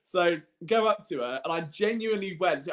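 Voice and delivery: ordinary talk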